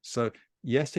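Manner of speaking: normal talking voice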